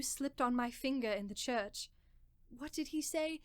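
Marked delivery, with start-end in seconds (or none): none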